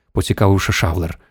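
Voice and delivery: ordinary delivery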